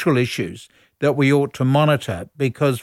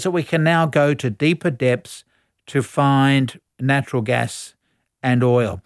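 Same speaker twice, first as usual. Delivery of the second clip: ordinary delivery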